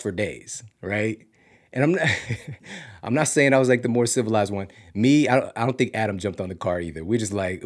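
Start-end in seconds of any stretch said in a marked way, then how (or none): none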